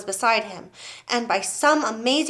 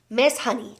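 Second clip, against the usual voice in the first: ordinary delivery, nothing marked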